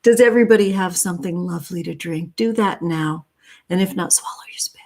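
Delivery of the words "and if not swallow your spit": quick unvoiced whisper, half joking; whispered aside. Real, not acted. Whispering a cheeky tip to her viewers, quick and hushed. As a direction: slip it in as a hushed joke.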